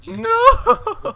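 high laughing shriek; laughing scream. Real, not acted. Laughing scream at game event.

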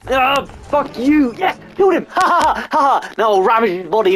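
triumphant yell. Real, not acted. Gamer after killing the bird, whooping. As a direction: cheer the kill. Wins the fight in game.

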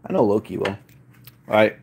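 usual speaking voice

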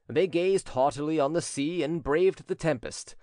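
usual speaking voice

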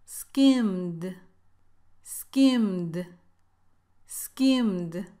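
Skimmed as one syllable, skimd; silent e. Shown as a demonstration. Teacher drilling Skimmed as one syllable. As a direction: drop the e in Skimmed. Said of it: "Esta E no tiene sonido".

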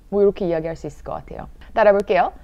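normal talking voice

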